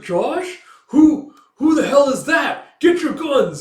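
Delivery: high, loud shouting soldier; alarmed shout. Retold or imitated. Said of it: "it's like".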